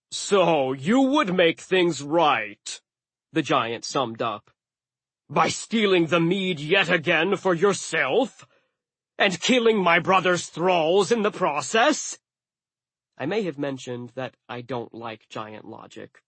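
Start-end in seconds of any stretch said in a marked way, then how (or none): 0.1-2.8 slow, raised giant voice; deliberate, challenging giant; accusing giant. Acted. 5.3-12.2 raised, higher giant voice; emphatic giant cross-examination; accusing giant. Acted.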